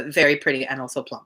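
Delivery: plain reading voice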